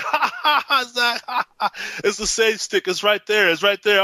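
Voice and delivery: high laughing tease; laughing teasing. Retold or imitated. Crew teasing Zach over the sage stick, laughing high. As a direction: laugh at him while pointing it out. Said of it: "And we're like".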